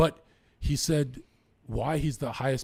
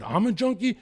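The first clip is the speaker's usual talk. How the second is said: higher, louder shocked question; higher, louder retort to the brother's junkie remark; indignant disbelief; 9 semitones higher, 5 dB louder than usual. Retold or imitated. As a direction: fire it back in disbelief. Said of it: "And I said".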